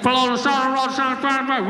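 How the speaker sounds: high, muddled loudspeaker imitation; garbled police loudspeaker. Irritated cop over car speaker, garbled. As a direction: slur it into an unintelligible tannoy order. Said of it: "couldn't hear what the hell he was saying".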